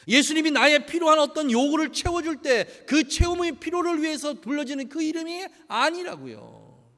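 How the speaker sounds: loud, high, impassioned sermon delivery; rising pulpit fervour; full-force preaching. Acted. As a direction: preach it out to the congregation. Preaching to congregation.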